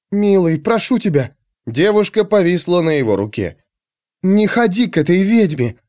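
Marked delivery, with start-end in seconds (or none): 0.1-1.4 high, imploring female voice; anxious, high-pitched entreaty; pleading woman. Acted. Girl begs the barbarian. 4.2-5.8 high, urgent plea; anxious, lightly voiced woman; pleading woman. Girl begs lover not to go.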